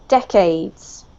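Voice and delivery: "Decades." stressed on DEC; teacher models "Decades." stress on DEC; stress on first syllable. Shown as a demonstration. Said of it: "the emphasis is on the first syllable".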